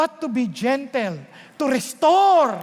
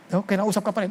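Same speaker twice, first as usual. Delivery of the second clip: ordinary talk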